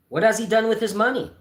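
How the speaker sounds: a nosy gentleman pressing the point; lightly voiced busybody; curious gentleman. Red-faced gentleman asks.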